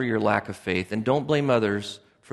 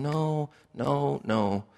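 softer, pained no from God; grieved no; 6 dB quieter, much slower than usual. Retold or imitated. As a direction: let the no fall away, heartbroken. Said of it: "Jehovah just looking at this going".

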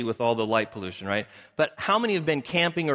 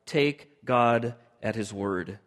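slow emphasis; 5 semitones lower, much slower than usual. Real, not acted.

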